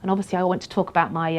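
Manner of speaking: ordinary talk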